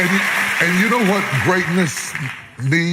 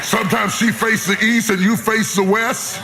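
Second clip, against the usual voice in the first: raised, higher preaching voice, playful; 4 semitones higher than usual. Acted. Preacher teasing the congregation about married life, raised preaching voice. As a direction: preach it up to the crowd with a grin.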